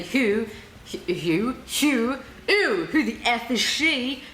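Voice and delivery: mock-scornful kids' "who?"; hammed-up disdain, drawn out at the end; imitating judgmental stares. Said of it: "evil stares kids love to give that say".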